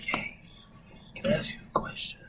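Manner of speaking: nearly voiceless, confidential murmur; hushed customer. Retold or imitated. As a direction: imitate a furtive, whispered question. Customer approaches secretively in story.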